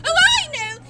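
excited. Acted. Sudden idea.

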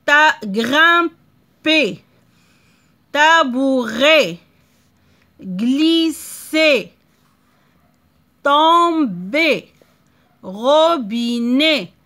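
each word separate, ending stressed and falling; word list read out. Shown as a demonstration. Teacher reading words to pupils: separated, falling endings. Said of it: "ça se prononce E".

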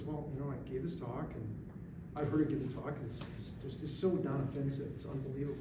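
low, soft, bewildered account; subdued recounting. Retold or imitated. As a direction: voice a shaken wife quietly explaining. Shaken wife recounts her talk.

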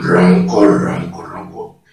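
low rasped repetition; hoarse. Shown as a demonstration. Reminding viewers of step two, hoarse. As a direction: drop low and roughen the voice, fading. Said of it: "el segundo paso sería hablar ronco".

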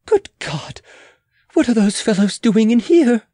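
startled queen's exclamation; exclaimed alarm. Acted. Queen seeing armed men in the yard, exclaiming. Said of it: "she exclaimed".

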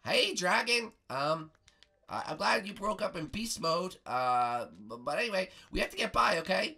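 put-on high boy addressing a dragon; hesitant, boyishly high request; Bart Simpson.

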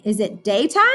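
normal talking voice

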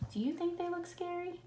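put-on high, soft question to the kids. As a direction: ask the children lightly. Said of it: "the ninja's thinking".

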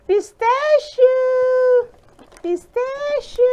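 high, bright announcement of a favorite; excited. Real, not acted. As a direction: squeal the name with delight.

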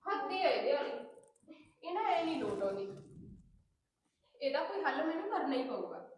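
soft, resentful muttering under her breath; fading private grumble; grumbling to herself. Acted. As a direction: mutter it so nobody hears. Muttering alone after quarrel.

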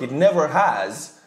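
normal talking voice

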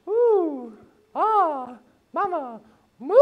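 very high, light woo, ah, mama, moo; silly high siren in the car; car warm-up siren sounds. Shown as a demonstration. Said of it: "what you're gonna do as you drive".

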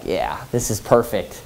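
low, soft, mock-scheming murmur; acted sly aside, dropped way down; quietly satisfied aside. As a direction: say it under your breath, privately pleased. [name] quietly pleased as others argue.

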